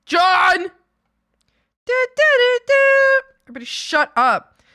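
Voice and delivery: loud, high shouting; loud yell. Real, not acted. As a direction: bellow at the chat. Yelling at chat.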